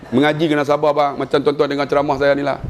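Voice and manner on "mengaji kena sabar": usual speaking voice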